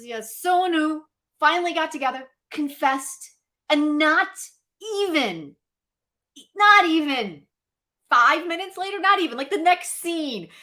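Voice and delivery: usual speaking voice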